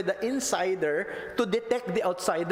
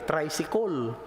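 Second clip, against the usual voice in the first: put-on Cebuano-accented "Tricycle", last syllable rounded to "-kol"; 2 semitones lower, slower than usual. Preacher showing the congregation how Cebuanos speak, Cebuano accent. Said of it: "Paano bigkasin ng mga Cebuano".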